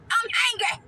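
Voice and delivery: high, moaning I'm hungry with hungry drawn out and falling; whiny hungry.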